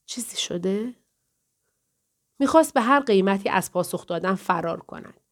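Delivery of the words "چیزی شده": soft whisper into his ear; whispered question in a lover's ear. A narrator's reading. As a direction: breathe the question close to him. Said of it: "در گوشش زمزمه کردم".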